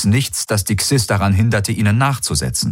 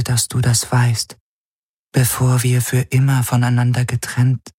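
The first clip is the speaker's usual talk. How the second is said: fading dream figure; 5 semitones higher than usual. A narrator's reading. The dream figure tells Garland she is fading, gently sad. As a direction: sound fragile, glad yet doomed. Figure's voice held over many lines.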